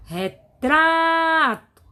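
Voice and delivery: "Retrato" with TRA stretched high and loud; stressed syllable exaggerated. Shown as a demonstration. Teacher finding the tonic syllable for students, TRA in "Retrato" drawn out forcefully. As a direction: call out "Retrato", holding TRA very long. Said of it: "Sílaba tônica é o tra".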